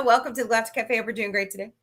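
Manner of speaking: ordinary delivery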